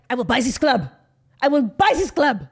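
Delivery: mock Anna Delvey accent, building to a loud boast; hammed-up accented club tantrum. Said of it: "He said it, like, on a Delvey".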